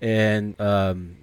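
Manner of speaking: usual speaking voice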